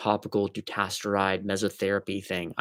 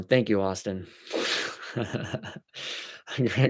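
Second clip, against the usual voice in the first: ordinary talk, nothing marked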